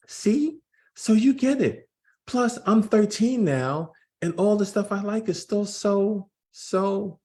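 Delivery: put-on young Jordan voice. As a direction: play the twelve-year-old son, eager. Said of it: "I'm gonna try to do voices to distinguish".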